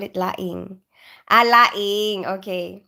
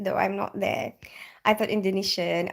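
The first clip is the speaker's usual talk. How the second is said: ordinary delivery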